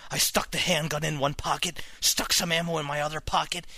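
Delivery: low, subdued narration; quiet story ending. A narrator's reading. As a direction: wind the tale down softly to a hushed close.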